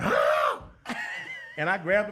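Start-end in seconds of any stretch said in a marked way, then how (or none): 0.0-0.6 mock pained yell. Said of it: "He said". 0.7-1.5 high laugh. Real, not acted. Laughing at the bite story.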